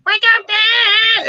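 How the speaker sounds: squeaky, slowed mock singer voice; stretched, cartoonish high squeal; mock screamed vocal line. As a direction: ham up a shrieking wake-up cry. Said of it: "and then goes like".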